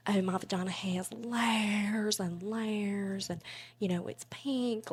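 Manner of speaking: slow, airy Southern drawl; performed Southern monologue voice; Southern accent. Shown as a demonstration. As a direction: draw out the words in a soft Southern accent. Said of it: "So that was Southern".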